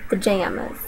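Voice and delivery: "pajamas" with a flat A, "puh-jam-uhs"; Southern "pajamas". Shown as a demonstration. Speaker demos the Southern "pajamas" with a flat A. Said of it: "second will be Southern version".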